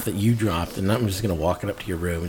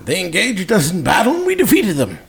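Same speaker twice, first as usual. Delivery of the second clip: higher, haughty noble voice; lightly voiced, proud claimant; Mercado's voice; 10 semitones higher, 4 dB louder than usual.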